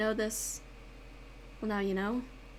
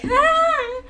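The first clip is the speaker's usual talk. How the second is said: sudden high shriek, much louder; retold scream; 12 dB louder than usual. A bee flies near her and she shrieks loud and high. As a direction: let out a startled shriek. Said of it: "and I'm just like".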